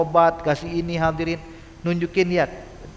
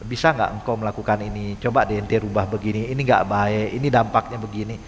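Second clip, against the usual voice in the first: gentle advice; 7 semitones lower than usual. Pretend. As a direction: counsel them heart to heart. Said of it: "dengan penuh santun, lemah lembut".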